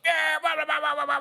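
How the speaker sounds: high, loud run of yelled blahs; loud imitation of rowdy onlookers; mock crowd shouting.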